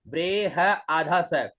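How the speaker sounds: ordinary delivery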